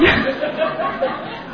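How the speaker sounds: high, breathy unvoiced laugh; breathless laugh. Real, not acted. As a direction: burst out in a silent high laugh. Laughs at son's retort.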